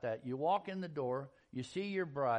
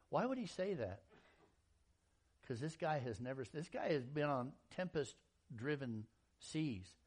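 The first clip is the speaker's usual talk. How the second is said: ordinary talk, nothing marked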